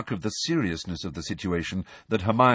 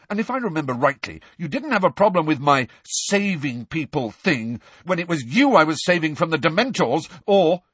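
rising anger, louder toward the end; angry; 9 semitones higher, 3 dB louder than usual. A narrator's reading. Harry rounding on his friends, angry and rising. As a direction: throw the past back at her. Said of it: "he rounded on Ron".

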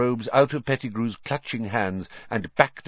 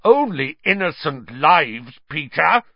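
loud, higher-pitched rebuke; forceful, scathing reproach; furious; 7 semitones higher, 5 dB louder than usual. A narrator's reading. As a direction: spit out the answer in fury. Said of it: "with a terrible fury".

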